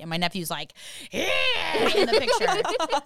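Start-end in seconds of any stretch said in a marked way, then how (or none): 1.1-3.0 mock excited kid. Imitates excited nephew in photo.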